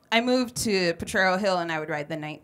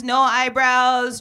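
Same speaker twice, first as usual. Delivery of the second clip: normal talking voice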